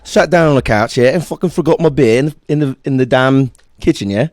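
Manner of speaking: slightly livelier British storyteller; imitated British. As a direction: mimic British chat, yeah as a tag. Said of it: "I like when British people say".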